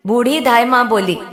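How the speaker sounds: usual speaking voice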